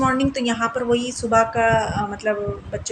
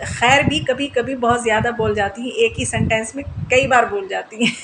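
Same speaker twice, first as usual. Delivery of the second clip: ordinary delivery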